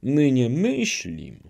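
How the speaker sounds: earnest relief, lightly voiced; joyful cry. Old man assuring Zagłoba, a touch of joy. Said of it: "zawołał z radością dziad".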